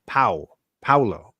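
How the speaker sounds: "Pow" as a comic punch, then "Paulo"; Batman-punch POW. Shown as a demonstration. Teaching the name with a Batman punch, punchy "Pow... Paulo". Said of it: "like Batman punches somebody".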